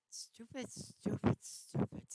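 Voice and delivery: loud, harsh self-scolding echo; a thought echoing in his head, scolding; echoing inner voice. A narrator's reading. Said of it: "his mind echoed".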